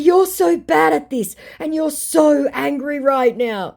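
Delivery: high, slow, singsong-like needling; mock taunting. Baiter goading the angry scammer, taunting.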